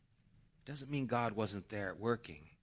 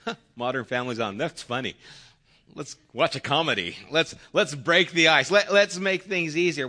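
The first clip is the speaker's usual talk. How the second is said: louder, higher, quick spouse act; mock breezy avoidance; 4 semitones higher, 9 dB louder than usual. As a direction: play a chirpy partner dodging trouble, building louder. Acting out a spouse avoiding the problem.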